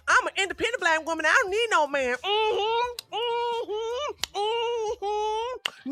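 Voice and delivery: hammed-up sassy woman; 1 mocking imitation.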